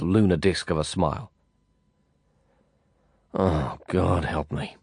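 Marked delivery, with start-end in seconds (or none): 3.3-4.8 slow, soft, sighing words; weary sigh. A narrator's reading. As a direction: sigh it out from deep inside. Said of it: "sighing all the way from the core".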